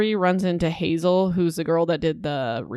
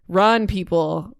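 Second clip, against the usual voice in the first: usual speaking voice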